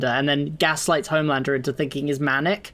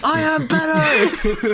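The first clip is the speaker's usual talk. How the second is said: high, drawn-out mock outburst; hammed-up Homelander shout; 11 semitones higher, much slower than usual. Echoing a friend's Homelander quote, hammed-up high shouting. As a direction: stretch it into a supervillain rant, half laughing.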